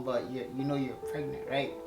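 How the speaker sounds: usual speaking voice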